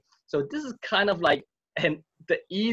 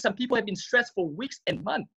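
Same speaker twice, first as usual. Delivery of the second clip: normal talking voice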